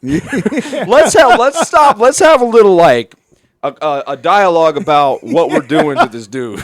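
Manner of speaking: a hammed-up laughing shout; laughing mock yell. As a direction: yell it while cracking up. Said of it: "I was like".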